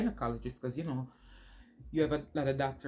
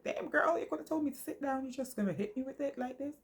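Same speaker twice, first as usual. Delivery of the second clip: much higher, mock-exasperated; incredulous, half-joking complaint; retelling her inner reaction in a high annoyed voice; 13 semitones higher than usual. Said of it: "kind of a little bit annoyed".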